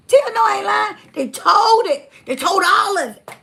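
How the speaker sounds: loud, emphatic insisting; insistent shout. Real, not acted. Insisting loudly to Tia she isn't lying, emphatic shout. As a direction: hammer it home to Tia.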